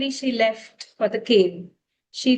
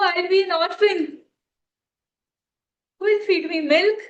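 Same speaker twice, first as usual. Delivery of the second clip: put-on higher, pleading child's tearful voice; crying calf; 7 semitones higher than usual. Calf begs its mother not to go, tearful and pleading. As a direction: beg her not to leave, near tears. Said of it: "the baby started crying profusely".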